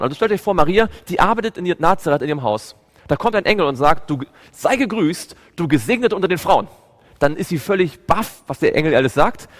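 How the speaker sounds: loud, driving sermon retelling; fervent preaching. Acted. Preacher to his congregation, loud fervent storytelling.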